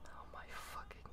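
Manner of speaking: fast exasperated whisper. Real, not acted. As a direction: breathe it out under your breath. Exasperated at teasing friends.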